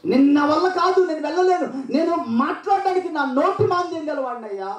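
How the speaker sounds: high, pleading stammerer's plea; pleading Moses. Retold or imitated. Moses pleading with God that he cannot speak, high and laughing. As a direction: beg out of the task with a half laugh. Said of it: "మోషే అని దేవుడు అన్నాడు".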